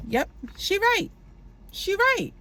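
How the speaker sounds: excited amen; high gleeful agreement. Real, not acted. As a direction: cheer her on in a high voice.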